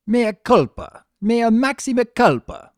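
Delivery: loud, high hammed-up cries of penance; theatrical loud wail of fake repentance; crying out. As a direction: howl it like a mock martyr under the lash. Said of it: "Discord cried out".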